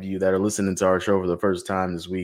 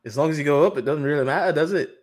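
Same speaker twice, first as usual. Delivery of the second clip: higher, lilting Scottish put-on; playful Scottish-Irish accent, voice climbing at the end; mock Scottish accent; 4 semitones higher than usual. Host defends muddled accent, higher lilting mock Scottish. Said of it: "Oh, that's Scottish".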